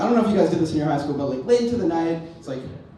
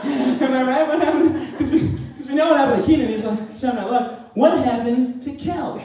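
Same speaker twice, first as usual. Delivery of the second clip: high, fast giddy nineties guy; mock excitement; 9 semitones higher, 3 dB louder than usual. Mocking the nineties talk guy, high giddy mock voice. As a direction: ham up the giddy nostalgic guy.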